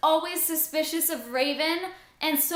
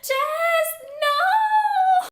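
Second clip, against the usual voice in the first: shrieking higher and louder, half sung, to a long wail of no; anguished no; 7 dB louder, much slower than usual. Retold or imitated. Reliving the book's ending, a climbing, sung-out wail of protest. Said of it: "But I also was like".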